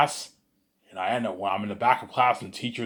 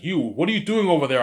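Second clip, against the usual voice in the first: louder, higher demand; teacher calling out; 5 semitones higher, 5 dB louder than usual. Retold or imitated. Teacher demands what the kid at the back is doing, loud call. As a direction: call him out across the room.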